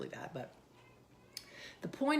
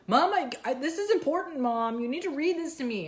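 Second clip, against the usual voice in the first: imitating whiny son; 10 semitones higher, 8 dB louder than usual. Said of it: "with his controller going".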